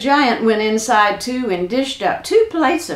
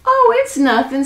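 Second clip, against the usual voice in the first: high, sly brush-off with a hint of a snicker; lightly snickered, pitched well up; 10 semitones higher, 4 dB louder than usual. Jack teases the nervous giant, lightly snickering. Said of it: "snickered Jack".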